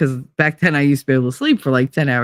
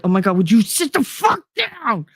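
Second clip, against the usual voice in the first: fed-up, pitched-up plea for him to sit down; exasperated snap; 8 semitones higher than usual. Retold or imitated. To a restless friend, exasperated and pitched up. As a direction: roll your eyes and beg him to sit still.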